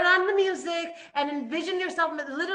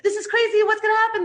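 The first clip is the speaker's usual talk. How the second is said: much higher and louder, frantic panic; panicked complaining; 7 semitones higher, 9 dB louder, faster than usual. Retold or imitated. Israelites complaining in the desert, loud frantic panic. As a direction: wail like the frightened crowd. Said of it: "the isness that they're complaining".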